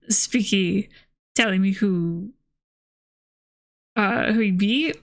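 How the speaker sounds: plain reading voice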